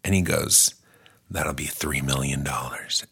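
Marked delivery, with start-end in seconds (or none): 1.3-3.0 low whispered price. Acted. Nelly asks fee.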